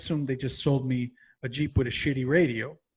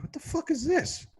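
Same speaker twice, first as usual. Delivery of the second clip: high incredulous squeak; 12 semitones higher, 3 dB quieter than usual. Retold or imitated. Finding the antenna, baffled.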